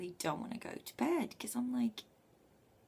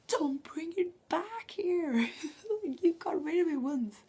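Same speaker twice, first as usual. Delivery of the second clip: laughing mock yell; 11 semitones higher, 7 dB louder than usual. When her housemate brings the suitcase back, a high, loud, laughing protest. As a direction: shriek it half-laughing, pushing him away.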